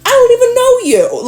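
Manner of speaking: squeaky high disbelief; incredulous. Real, not acted. Reader baffled at a stranger taking offense, incredulous. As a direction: let it shoot up in amazement.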